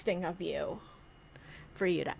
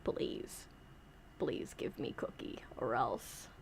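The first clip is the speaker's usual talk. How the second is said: low, unvoiced threat, much softer; menacing puppet whisper; 10 semitones lower, 13 dB quieter, slower than usual. Puppet whispering a threat at Santa. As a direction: whisper the threat like a tiny villain.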